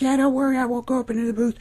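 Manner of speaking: falsetto mock-reassuring girl; Emma. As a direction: imitate her promising, very high. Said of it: "and Emma's like".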